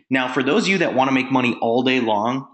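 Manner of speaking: usual speaking voice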